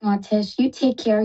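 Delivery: ordinary talk, nothing marked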